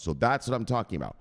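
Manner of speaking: normal talking voice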